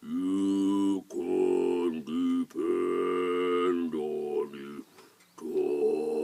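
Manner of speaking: low, extremely slow, droning voice; ponderous, stretched-out hill character. Acted. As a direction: drone it deep and long as an old hill. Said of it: "in a very hill-like voice".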